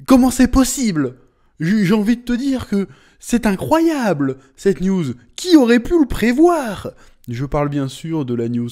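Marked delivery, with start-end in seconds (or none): 0.1-6.9 much higher and louder mock amazement; hammed-up disbelief. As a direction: overplay the shock. (incrédulité surjouée, aiguë et forte)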